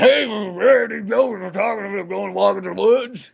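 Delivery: a hint of a haggard hiker, eager; mock cheerful old man. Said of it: "He's like".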